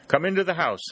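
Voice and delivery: eagerly hurrying the soldiers inside; hearty exclamation; exclaiming. A narrator's reading. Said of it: "she exclaimed".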